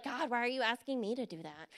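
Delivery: muted, imitated reluctance; acted reluctant complaint. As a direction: drop down into a small self-absorbed grumble. Acts out self-focused complaint to God.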